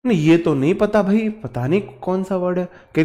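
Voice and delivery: ordinary talk, nothing marked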